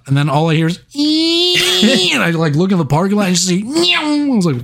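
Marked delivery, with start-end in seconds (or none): 0.9-2.1 high whining motor noise; toy car whine. Retold or imitated. 3.6-4.0 high squeaky meow; mock meow. Imitating RC car noise.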